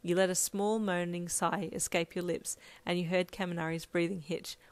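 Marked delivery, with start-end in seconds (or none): none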